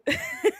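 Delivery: very high squeal of laughter; shrill little giggle. Real, not acted. Laughs at chat.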